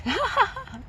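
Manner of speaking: sudden high-pitched laugh; high burst of laughter. Real, not acted. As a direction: burst out laughing at your own pun. Laughing at own pun.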